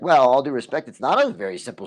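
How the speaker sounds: a mock-firm retort to the editor; retold pushback. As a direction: push back hard but politely. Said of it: "And I was like".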